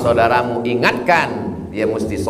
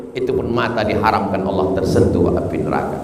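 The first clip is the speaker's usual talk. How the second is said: usual speaking voice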